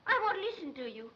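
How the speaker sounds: defiant shouting at the accuser; furious outburst. Acted. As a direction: lash out, building to a loud insult. Cornered girl turns on interrogator.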